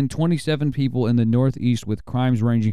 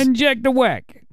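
mafia voice; 13 semitones higher, slower than usual. Pretend. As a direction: announce it like a mob boss. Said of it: "I use my mafia voice".

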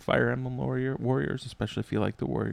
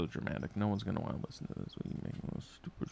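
muttering under breath; hushed grumble; whispered aside; 4 semitones lower, 14 dB quieter, much faster than usual. Real, not acted.

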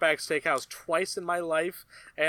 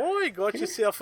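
fake Australian accent; 5 semitones higher than usual. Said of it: "It's like".

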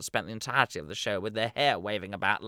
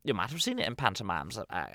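normal talking voice

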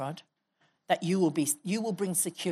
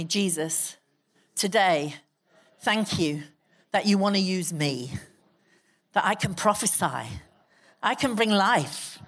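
fervent prayer; 2 semitones lower, 3 dB louder than usual. Acted. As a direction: pray it out with holy boldness for all to repeat. Leads the congregation in prayer.

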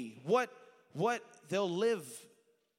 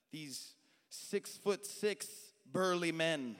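usual speaking voice

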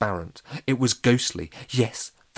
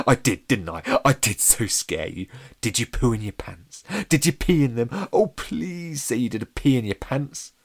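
loud, eager gloating, climbing higher; excited; 3 semitones higher, 6 dB louder than usual. A narrator's reading. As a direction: pester them gleefully for a reaction. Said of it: "said Chomper excitedly".

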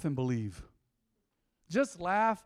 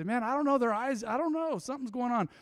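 higher, puzzled mock voice; imitating a confused onlooker; 7 semitones higher than usual. Preacher acting out a puzzled friend, higher voice. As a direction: play the baffled friend lightly. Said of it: "did a real weird bug-eyed thing".

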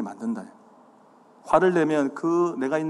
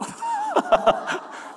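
real high burst of laughter. Real, not acted. Preacher teasing listeners for reacting late, laughing.

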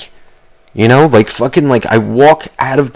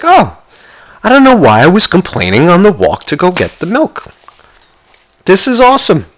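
sudden delight; 8 semitones higher than usual. Retold or imitated. Inner voice, back with partner.

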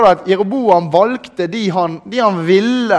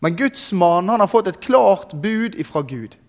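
normal talking voice

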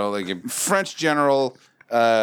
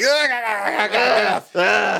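high mock-disgusted "ugh" noises; repulsed groan; 9 semitones higher, much slower than usual. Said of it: "this repulsive manner in which".

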